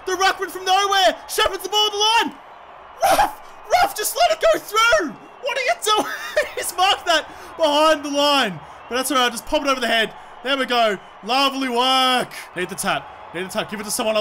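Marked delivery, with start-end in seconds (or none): 0.1-8.6 very high, alarmed squawking at a teammate; high-pitched disbelief at the game; frantic yelling. Real, not acted. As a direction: shriek instructions in rising panic.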